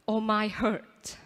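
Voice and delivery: ordinary talk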